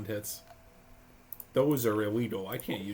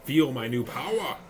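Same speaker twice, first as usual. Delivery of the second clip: megalomaniac rant; 4 semitones higher, 7 dB louder than usual. Pretend. Gamer hams villain boasts.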